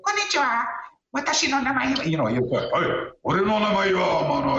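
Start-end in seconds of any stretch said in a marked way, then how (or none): 0.0-2.0 very high, dainty mock-polite Japanese; unmanly polite Japanese. Shown as a demonstration. Storyteller showing how men can't talk, high polite Japanese. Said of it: "guys always have to growl when they talk. You, you can't say". 2.6-4.6 growled tough-guy Japanese; manly growl. Shown as a demonstration. Storyteller showing how guys must talk, manly growl. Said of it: "guys always have to growl when they talk".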